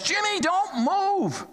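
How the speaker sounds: high, slow alarmed call; a father calling to his boy on the roof; dad yelling. Retold or imitated. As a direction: call up to the son, slow and urgent. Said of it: "John yells".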